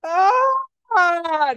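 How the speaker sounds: high, loud mock sob gasp; the cry that won't come out; hammed-up stifled wail; choked cry. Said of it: "Your face go".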